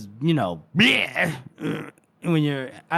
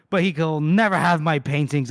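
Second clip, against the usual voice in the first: ordinary delivery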